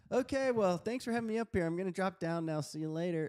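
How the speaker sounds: high, bright sign-off voice; mock polite exit. Imitating a guest politely dropping off the stage, high and hammed-up. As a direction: ham up a speaker bowing out.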